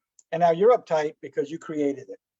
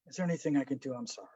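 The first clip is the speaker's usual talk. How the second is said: sincere sympathy; 4 semitones lower, 10 dB quieter, much faster than usual. Shown as a demonstration. Sympathizing with Bill in hall.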